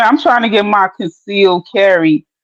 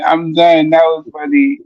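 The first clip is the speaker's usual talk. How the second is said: ordinary talk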